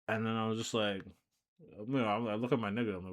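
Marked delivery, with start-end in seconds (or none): none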